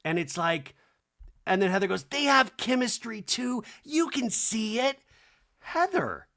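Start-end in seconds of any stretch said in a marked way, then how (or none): 2.1-5.0 high, slow insisting voice; insistent meddler. Retold or imitated. Acting Heather's insistence. 5.6-6.3 very high-pitched cry of the name; exasperated. Real, not acted. Exasperated scolding of Heather.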